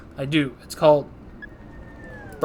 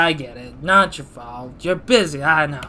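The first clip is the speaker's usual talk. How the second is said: ordinary talk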